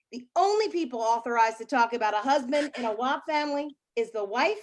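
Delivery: ordinary talk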